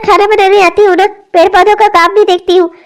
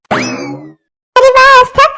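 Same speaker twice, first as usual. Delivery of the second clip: amazed; 3 semitones higher, much slower than usual. Acted. Mother amazed baby went quiet.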